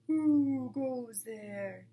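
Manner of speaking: slow, loud, booming challenge; deep voice. A narrator's reading. Unseen badger challenging the foxes, slow and booming. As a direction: boom it from above, very slowly. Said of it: "a deep voice above their heads said".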